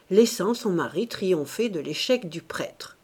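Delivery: plain reading voice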